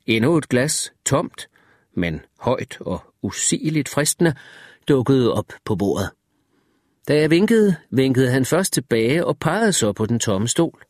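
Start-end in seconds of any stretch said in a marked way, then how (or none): none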